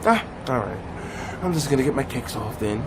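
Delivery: low, soft, sighing resignation; acted deflated murmur; resigned partner. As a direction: sigh it out, quietly giving up. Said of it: "he'll come back to you and be like".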